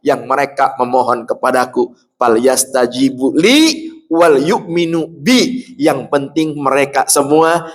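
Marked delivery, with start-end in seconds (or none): 2.2-5.7 slow, drawn-out verse recitation; Quran recitation. Acted. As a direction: chant the verse slowly and reverently. (tilawah ayat perlahan)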